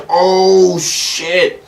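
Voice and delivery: high, startled oh shit; squeaky alarmed exclamation; mock panic. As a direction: freak out as you scramble to fix it. Jaw comes off, panicking.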